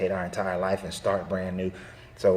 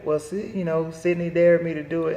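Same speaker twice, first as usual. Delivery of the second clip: lightly voiced sheepish boy, higher; a hint of a kid making excuses; son's excuse; 8 semitones higher, 3 dB louder than usual. Son blames his sister for the dare, a lightly sheepish kid voice. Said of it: "And he's like".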